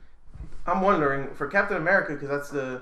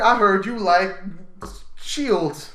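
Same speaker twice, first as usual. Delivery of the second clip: higher, louder mock Nick Fury voice, slow at the end; Samuel Jackson impression; 8 semitones higher, 8 dB louder, slower than usual. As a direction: ham up a tough guy pitching his agency. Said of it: "That's my Samuel Jackson impression".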